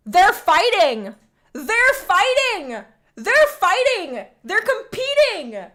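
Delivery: loud, high, slow gleeful shout; excited shouting. Real, not acted. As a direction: shout it out with glee. Delighted at rival champions.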